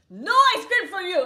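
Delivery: high, booming mock outburst; mock angry shout. Ice cream man banishing a customer, mock furious shout. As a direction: bellow it like a comic tyrant.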